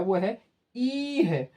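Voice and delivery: ई said slow and careful; short ई demo. Shown as a demonstration. Teacher showing the short vowel to pupils. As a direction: say the ई in वो है ई है slowly. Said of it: "छोटी ई का sound होता है".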